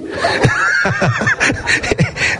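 breathy burst of laughter; real laugh. Real, not acted. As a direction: let the laugh fall away. Laughing at his own joke. (risa espontánea)

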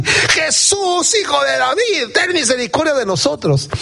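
very high, hammed-up desperate yell; frantic shouting. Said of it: "se aceleraron más".

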